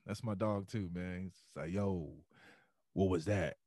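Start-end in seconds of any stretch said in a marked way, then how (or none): 1.7-3.6 a lightly voiced low rasp; rough low veteran's call; raspy Mike Anderson. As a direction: veteran grabs rookie's neck, a hint of rasp. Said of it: "he got this real raspy voice".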